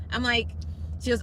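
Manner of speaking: normal talking voice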